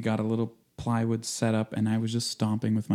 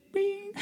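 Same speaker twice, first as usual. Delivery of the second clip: soft, very high "bing"; high vocal ping; high-pitched ringing snare imitation; 4 dB quieter than usual. Shown as a demonstration. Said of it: "it's really high pitched".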